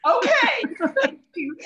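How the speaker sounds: relieved giggly okay; laughing relief. Retold or imitated. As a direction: laugh out your thanks.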